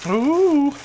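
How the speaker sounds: high, loud delighted coo; a rising coo of delight; delighted ooh. Real, not acted. Vlogger opening Susan's package, a high delighted coo.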